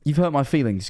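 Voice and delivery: ordinary talk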